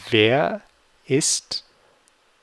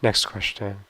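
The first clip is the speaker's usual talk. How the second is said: ordinary talk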